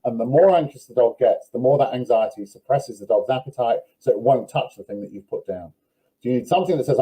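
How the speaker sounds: ordinary delivery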